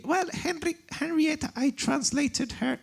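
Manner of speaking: lightly mocking high voice of a polite translator; imitating the translator. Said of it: "I don't know if she talks like this".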